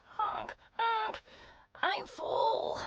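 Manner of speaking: tiny high-pitched goose voice. Acted. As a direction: squeak it out like a small bird. Goose character speaks.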